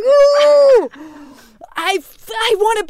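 very high, loud drawn-out "ooh"; excited squeal. Retold or imitated. Actor hearing her character was invited back, excited squeal. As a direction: squeal with giddy longing. Acts out joy at character's return.

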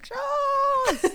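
very high, drawn-out mock scream; screaming shark voice. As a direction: squeal it as a silly attacking shark. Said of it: "In that voice".

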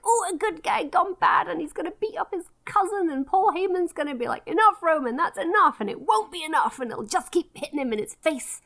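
high, breathless-fan style melodrama; soap opera excitement. Acted. Fan relishing a wrestling soap opera, excited mock drama. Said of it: "This is my soap opera".

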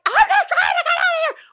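very high, loud hammed-up wail; retold frantic shout. Frantic mother answering annoyed haunted-house crowd, hammed-up hysterical shout.

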